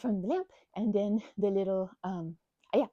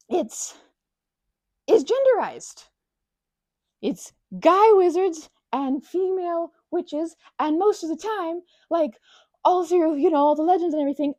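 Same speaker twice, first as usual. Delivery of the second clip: louder, much higher indignant rant about witches; mock indignant rant; 6 semitones higher, 8 dB louder than usual. Real, not acted. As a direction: fume about the unfair labels. Ranting about wizards and witches.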